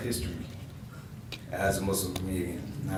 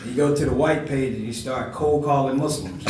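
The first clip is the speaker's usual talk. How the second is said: ordinary talk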